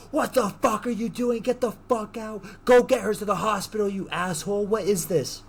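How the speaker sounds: high-pitched, frantic telling-off; mock outraged alarm. Friend demanding a hospital trip, mock outraged alarm. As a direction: imitate an appalled friend ordering them out. Retold friend reacts to bloody stabbing.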